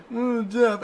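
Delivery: high put-on kid voice; kid's meme quote. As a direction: squeak it like a kid doing the meme. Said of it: "your kid was like".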